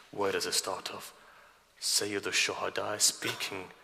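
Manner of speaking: soft, low, intimate telling of a sacred story; hushed sermon narration. Acted. Preacher softly recounting Ali Akbar to the gathering, hushed. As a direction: draw the mourners in quietly.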